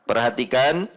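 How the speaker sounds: ordinary talk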